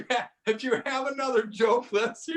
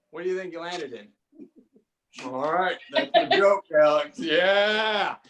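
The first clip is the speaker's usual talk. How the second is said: normal talking voice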